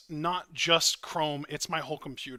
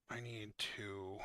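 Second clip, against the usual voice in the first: much softer, defeated mumble; defeated muttering; 3 semitones lower, 9 dB quieter than usual. Real, not acted. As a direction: mutter to yourself, resigned. Defeated muttering over computer.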